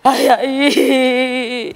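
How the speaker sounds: slow, drawn-out fake crying; mock sobs, laughing through them; hammed-up sobbing. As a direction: wail it like a stage mourner. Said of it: "performance काय बघताय".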